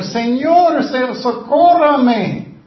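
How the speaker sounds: high, loud, slow begging; pleading cry. Acted. Mother on her knees begging the Lord, loud plea. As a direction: beg from your knees. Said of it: "Ella está rogando".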